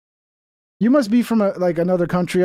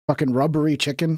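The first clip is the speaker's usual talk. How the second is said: ordinary talk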